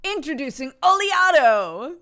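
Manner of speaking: loud, high commercial announcer. Pretend. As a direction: announce it like a product launch. Mock ad announcement.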